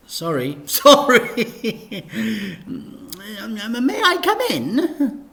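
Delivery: high, laughing vicar apology; high giggling vicar; giggly vicar. Acted. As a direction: titter through it nervously.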